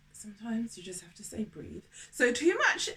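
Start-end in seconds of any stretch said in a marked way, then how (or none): 0.1-1.8 soft unvoiced whisper. Real, not acted. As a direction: breathe it out as a whisper.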